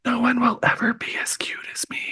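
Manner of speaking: whispered boast from a jealous ghost; Tomo's whisper. Acted. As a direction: play the possessive ghost in a whisper. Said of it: "like, whispering".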